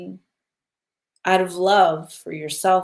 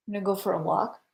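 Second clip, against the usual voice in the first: usual speaking voice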